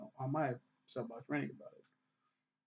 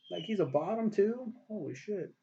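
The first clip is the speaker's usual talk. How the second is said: amazed disbelief; 8 semitones higher, 3 dB louder than usual. Real, not acted. Disbelief at the twist.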